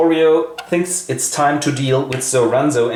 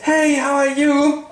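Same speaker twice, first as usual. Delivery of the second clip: much higher, slow and sugary; drawn-out lovestruck hello; a pitched-up girlish greeting, slow; mock doting sister; 13 semitones higher than usual. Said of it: "she has only eyes for him and goes".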